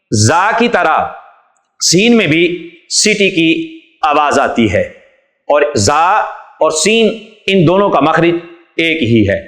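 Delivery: usual speaking voice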